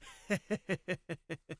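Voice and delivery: rippling sinister snicker; villain's chuckle. Acted. Skullman watching Garo kill them, sinister chuckle. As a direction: snicker with sick pleasure.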